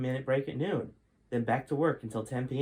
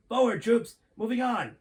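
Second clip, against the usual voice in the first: much higher and louder, a barked command; bellowing general; 11 semitones higher, 7 dB louder than usual. Acted. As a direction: bellow it like a drill sergeant. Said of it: "the general who bellowed".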